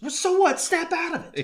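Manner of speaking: high, sharp mock outburst; worked-up, high-pitched scolding; intensely angry. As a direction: imitate an angry movie rant. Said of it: "intensely angry".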